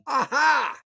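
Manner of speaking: high, delighted Aha; gotcha exclamation. Retold or imitated. Catching the client out in his own words, a triumphant high Aha. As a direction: leap up high like catching someone out. Playful gotcha in retold dialogue.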